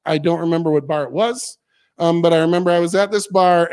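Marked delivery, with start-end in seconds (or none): none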